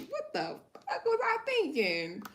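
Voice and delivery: high, slow, incredulous self-quote; stunned at your own past choices; disbelief at herself. Retold or imitated. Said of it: "it be like".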